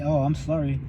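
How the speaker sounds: normal talking voice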